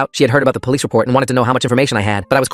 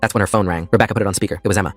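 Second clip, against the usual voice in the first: usual speaking voice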